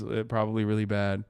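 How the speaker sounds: usual speaking voice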